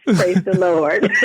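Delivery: slow, laughing praise; laughing through it. Real, not acted. Guest laughing at herself after forgetting her place, slow laughing praise. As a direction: laugh the words out slowly.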